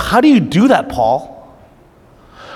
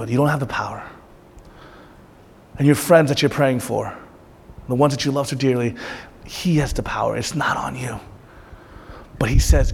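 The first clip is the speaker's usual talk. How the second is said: hushed tender preaching; 6 semitones lower, 7 dB quieter than usual. Acted. Pastor softly reassures his congregation, hushed and tender. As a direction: ease the burden off the listeners gently.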